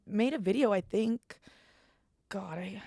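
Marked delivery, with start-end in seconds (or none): 2.3-2.8 unvoiced breathy aside; real whispered worry. Real, not acted. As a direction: whisper it to yourself.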